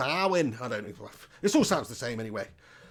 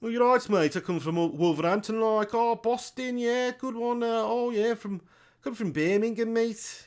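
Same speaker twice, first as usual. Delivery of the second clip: higher-pitched put-on Brummie accent; Birmingham accent; 7 semitones higher than usual. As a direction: imitate a Brummie, pitched well up. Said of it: "they've got a completely different accent".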